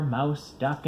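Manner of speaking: plain reading voice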